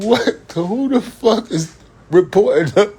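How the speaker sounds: high, slowed, laughing mock outrage; retelling his own reaction to mama, high laughing mock disbelief; mock shocked self. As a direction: play your younger self caught off guard, up high. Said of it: "And I be like".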